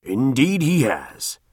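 slow, lightly voiced governor; a hint of a yielding magistrate; governor conceding.

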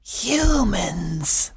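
unvoiced spooky whisper. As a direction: whisper it in disgust. Creature hiding whispers.

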